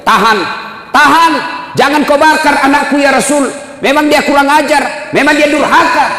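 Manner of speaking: a mother begs them to stop; mother's cry. Acted. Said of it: "ibunya berteriak".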